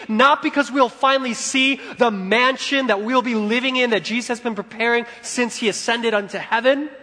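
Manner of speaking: high, driving sermon cadence; preaching at full force. Real, not acted. Preacher describing the great banquet to come, fervent preaching. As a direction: build through each repeated phrase.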